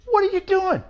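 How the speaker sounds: high, loud mock disbelief; astonished what are you doing; incredulous retort. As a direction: act caught off guard, voice jumping high. Finding the rock star pedaling.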